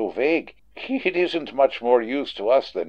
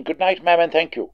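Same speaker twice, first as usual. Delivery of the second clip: normal talking voice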